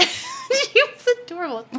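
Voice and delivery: high laughing delight. Real, not acted. Recalling the tiny healer, laughing fondly. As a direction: laugh it out, charmed. Fond memory, laugh heard.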